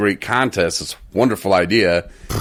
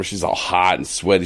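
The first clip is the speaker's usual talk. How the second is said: ordinary talk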